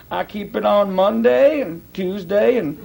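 slow drawn-out imitation of J. Vernon McGee; lightly voiced slow old preacher listing the days; slow McGee drawl. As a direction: stretch out each day of the week slowly. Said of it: "it took him a long time to say it".

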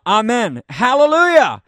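loud, high ringing "Hallelujah"; joyful loud "Amen. Hallelujah"; proclaimed hallelujah. Acted. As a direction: proclaim it like a shout of worship. Preacher proclaims scripture shout.

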